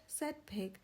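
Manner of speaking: usual speaking voice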